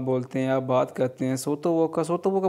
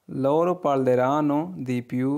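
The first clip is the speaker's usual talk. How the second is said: very slow, each Italian word laid out clearly; slow model reading; much slower than usual. Shown as a demonstration. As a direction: read it slowly for learners to copy. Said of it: "teacher models Italian sentence". (धीरे-धीरे साफ़ उच्चारण)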